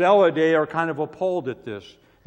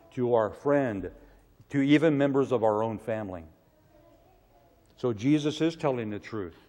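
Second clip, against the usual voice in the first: normal talking voice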